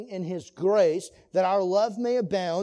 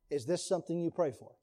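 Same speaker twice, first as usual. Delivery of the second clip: soft, low questions with long pauses; hushed, searching; 6 semitones lower, 8 dB quieter than usual. Real, not acted. Preacher asking couples whether they pray for love, hushed. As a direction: drop your voice and let each question sink in.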